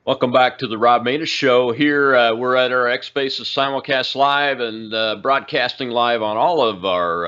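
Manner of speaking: loud, high show-host welcome back; loud radio announcer. Real, not acted. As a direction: boom it out like a radio host returning. Host returns from break.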